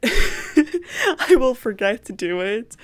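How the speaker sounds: laughing, stretched out slowly. Real, not acted. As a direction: laugh through the words.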